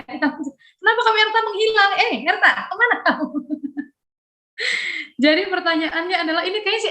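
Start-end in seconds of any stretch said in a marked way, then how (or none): 0.8-3.3 a teasing hey, where'd you go; playful call out. Acted. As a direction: call out to a friend who vanished. Host calls absent participant Erta.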